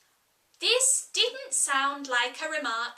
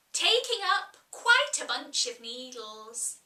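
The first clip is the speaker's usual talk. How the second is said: usual speaking voice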